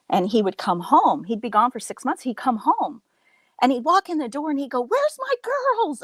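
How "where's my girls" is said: high drawn-out joyful call; excited dad. Retold or imitated. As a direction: imitate a dad calling his girls, very high and stretched. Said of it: "he was so excited".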